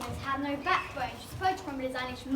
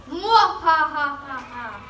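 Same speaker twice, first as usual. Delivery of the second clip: slow booming evil laugh; evil villain cackle; 5 dB louder, much slower than usual. Acted.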